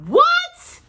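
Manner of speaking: sudden loud high "What?"; startled shout of disbelief; loud cry. A narrator's reading. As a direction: blurt it out, shocked and loud. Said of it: "cried Paddington in a loud voice".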